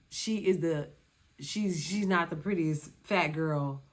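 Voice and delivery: ordinary talk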